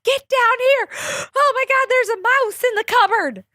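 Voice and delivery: high, frantic, imitating mom's alarm; panicked mom. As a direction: squeal it like a mom who just saw a mouse. Mom panicking about a mouse.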